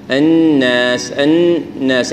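الناس slowly, two-beat ghunna on the nun then a clean alif; correct ghunna. Shown as a demonstration. Teacher models the right ghunna in الناس. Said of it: "غنة بحركتين".

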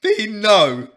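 loud, high desperate cry; shouting. Real, not acted. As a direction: yell it to stop him. Friend begs him to stop.

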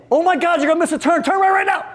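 high, frantic urging; the boss panics as the turn approaches; panicked boss. Acted.

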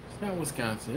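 muted, doubtful aside; doubtful guess. Acted. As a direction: say it softly to yourself, unconvinced. Debating a guess with himself.

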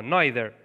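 "neither" as NYE-ther, tongue against teeth; voiced th with tongue. Shown as a demonstration. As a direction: say "neither" with a th like Arabic dhal. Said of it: "به کمک زبون و دندون ها".

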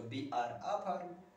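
each word drawn out very slowly; slow dictation. Shown as a demonstration. Teacher slowly dictating the English sentence to students. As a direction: spell it out word by word for the class. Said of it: "हम एक किसान हैं".

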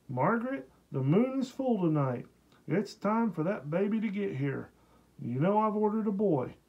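Billy calling excitedly across the room to Margaret; high, eager call to his wife. A narrator's reading. Lightly voiced husband calling out to Margaret, excited. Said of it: "he called".

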